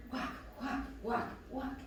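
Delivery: soft rhythmic whisper of each walk. Acted. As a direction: hush it like tiptoeing. Kids yoga, walking the dog.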